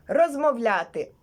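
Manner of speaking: ordinary talk, nothing marked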